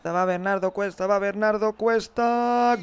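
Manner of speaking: tense commentator build-up; building suspense. Real, not acted. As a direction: pitch climbing, last name stretched. Suspense before the kick.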